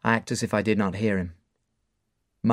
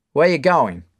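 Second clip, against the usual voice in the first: loud questioning; bar owner; 5 semitones higher, 8 dB louder than usual. Acted. Mark stopping Dave at the door: loud question. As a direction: stop him leaving.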